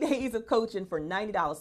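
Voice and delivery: usual speaking voice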